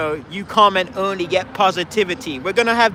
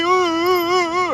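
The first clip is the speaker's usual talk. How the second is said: mock whimpering; 9 semitones higher than usual. Mock whimper inside parody.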